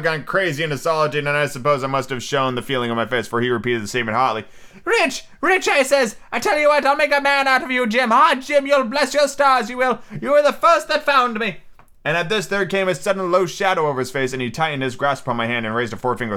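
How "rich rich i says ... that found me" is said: Ben Gunn voice. A narrator's reading. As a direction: confide like a lonely hermit.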